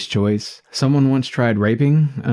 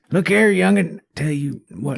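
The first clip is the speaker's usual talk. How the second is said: put-on higher-pitched cranky old man; 8 semitones higher than usual. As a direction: play a grumpy grandpa reminiscing. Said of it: "the old man voice".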